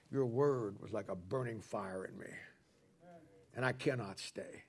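low, hushed admission after a sigh; subdued confession. Acted. Jeremiah confessing to the Lord that he cannot stop, hushed and subdued. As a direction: softly own up, voice dropped.

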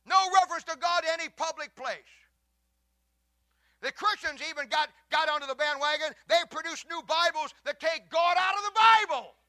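loud, high, impassioned sermon delivery; full-force preaching. Acted. Pastor preaching hard to the congregation, loud and fervent. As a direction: thunder it from the pulpit.

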